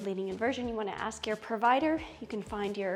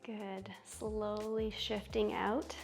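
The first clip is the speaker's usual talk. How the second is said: soothing yoga teacher; 8 dB quieter, slower than usual. Acted. Guides yoga poses slowly.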